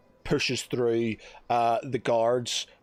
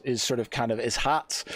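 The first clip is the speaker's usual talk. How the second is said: usual speaking voice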